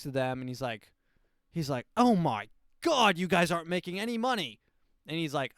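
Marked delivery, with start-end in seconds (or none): 2.0-4.6 higher, louder, stretching out the first gasp; a sudden startled cry of outrage; shocked outburst. Retold or imitated. Shocked discovery on factory floor.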